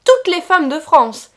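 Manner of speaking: loud mocking cry; loud gloating cry; shouted. A narrator's reading. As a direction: shout it over her head. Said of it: "cria-t-il".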